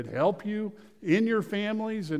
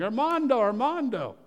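mock yell; 8 semitones higher than usual. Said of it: "pulling rocks off and yelling".

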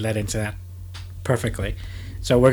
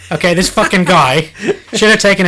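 giggling through the words; barely holding the laugh in; 5 semitones higher, 5 dB louder than usual. Real, not acted. Host reading absurd news to co-host, laughing.

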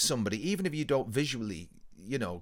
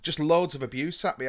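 ordinary delivery

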